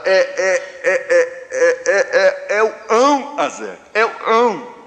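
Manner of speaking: put-on high, stammering old aunt; nervous stutter. Flustered great-aunt answering grandfather from the kitchen, nervous stammer. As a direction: stutter on the first word, then blurt the rest. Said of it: "já nervosa também. Gaga".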